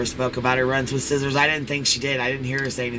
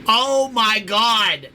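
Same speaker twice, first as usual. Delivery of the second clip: shocked; 7 semitones higher, 4 dB louder, much slower than usual. Real, not acted. Reacting to shocking HPV remark.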